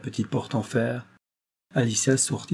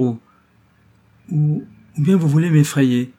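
higher, louder, faltering question; alarmed; 5 semitones higher, 6 dB louder than usual. A narrator's reading. Young woman fearing she is being frightened on purpose, alarmed. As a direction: stumble on the first word, then burst out in alarm. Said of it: "ajouta la jeune fille alarmée".

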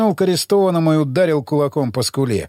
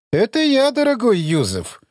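haughty; 7 semitones higher than usual. Acted. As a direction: look down on him as you greet him. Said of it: "надменный голос эмиссара".

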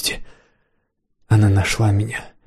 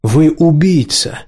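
raised, slow accusing voice; loud; 5 semitones higher, slower than usual. A narrator's reading. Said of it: "переспросил Лэндон громко".

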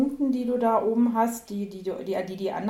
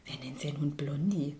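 whispered disbelief; 4 semitones lower, 5 dB quieter than usual. Real, not acted. Ungläubige Frage, geflüstert.

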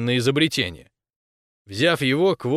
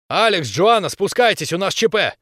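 high, raised commanding call; urgent order; 6 semitones higher, 4 dB louder than usual. A narrator's reading. Captain calling his team down to an emergency, ordering. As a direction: summon them urgently, voice lifted. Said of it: "приказал я".

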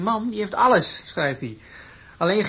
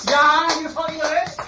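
grand professor; 8 semitones higher, 4 dB louder than usual. Acted. Professor asked, raised voice.

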